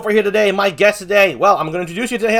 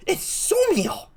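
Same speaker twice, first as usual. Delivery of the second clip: much higher, slow, stretched-out silly voice; silly put-on voice; 13 semitones higher, 4 dB quieter, much slower than usual. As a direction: stretch out the name in a goofy mock voice. Host teasing about guest's voice.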